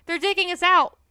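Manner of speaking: loud, high alarmed shout. A narrator's reading. Mister Fox shouting to wake his family, loud alarm. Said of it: "he shouted".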